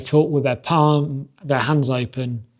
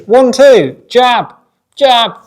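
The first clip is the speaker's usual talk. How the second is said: much higher, louder barked combo calls; corner shouting calls; 9 semitones higher, 11 dB louder, much slower than usual. Retold or imitated. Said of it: "all you hear is".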